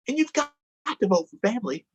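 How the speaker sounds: high, eager cartoon donkey; perky donkey pitching for votes. Donkey asking kids for votes, high and eager.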